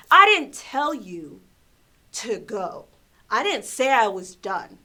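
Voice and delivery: loud, drawn-out retold telling-off; loud mock reprimand; mean customer scolding. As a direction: mimic a mean man scolding, hitting words hard. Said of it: "when someone gets mean like that".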